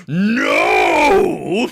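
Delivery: huge drawn-out defiant shout; bellowed refusal, stretched out; Caesar. Acted. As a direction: roar it out, long and loud. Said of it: "to quote a line from, from Caesar".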